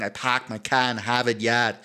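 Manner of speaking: Boston accent, every R dropped; broad Boston vowels, "pahk" and "yahd". Shown as a demonstration. Quoting the old catchphrase for co-host, Boston accent. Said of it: "Boston would be like".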